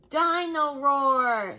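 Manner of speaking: loud, slow, awed naming; excited toddler. Acted. George hears the toy's name, loud and awestruck. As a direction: draw it out in wonder.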